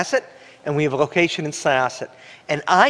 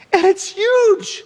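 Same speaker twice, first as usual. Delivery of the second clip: stretched out, much higher, astonished; awed; 5 dB louder, slower than usual. Retold or imitated. Pastor recalling the vast empty auditorium, awestruck and slow. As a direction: let the size hit you, slow and high.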